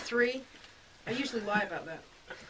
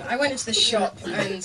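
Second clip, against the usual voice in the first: ordinary talk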